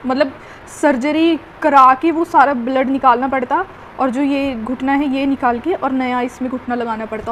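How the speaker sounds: ordinary delivery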